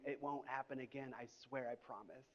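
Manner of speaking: soft, fading plea; pleading. Retold or imitated. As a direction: beg quietly like a caught cheater. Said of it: "on his knees begging".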